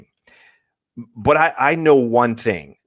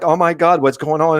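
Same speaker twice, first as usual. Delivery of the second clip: higher-pitched, retold alarm; mock panic; 6 semitones higher, 3 dB louder than usual. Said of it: "it was big panic there".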